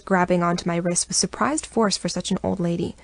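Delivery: ordinary delivery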